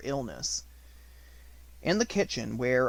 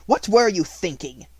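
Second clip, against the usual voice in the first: loud, higher demand; 6 semitones higher, 10 dB louder than usual. A narrator's reading. Headmistress demanding an answer, loud and sharp. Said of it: "demanded Miss Minchin".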